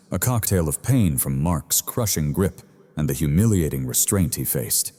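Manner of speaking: plain reading voice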